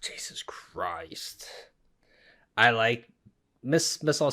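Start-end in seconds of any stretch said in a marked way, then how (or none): 0.0-1.5 breathless, much softer whisper; awed whisper. Real, not acted. Reacting to the Miss All Sunday entrance, awed whisper. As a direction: barely breathe it out, stunned.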